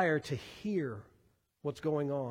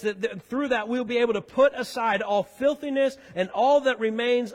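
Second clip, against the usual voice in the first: louder, higher sermon voice, driving each clause; full-force preaching; 5 semitones higher, 7 dB louder than usual. Acted. Preacher proclaiming the gospel to the church, loud and rising. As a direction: pastor to the congregation, swelling into full preaching.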